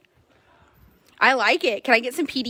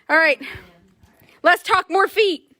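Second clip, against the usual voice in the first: ordinary delivery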